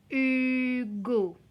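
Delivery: "Hugo" stretched for careful listening; sounded-out word. Shown as a demonstration. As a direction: call out "Hugo" slowly in two syllables. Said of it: "Écoutez bien".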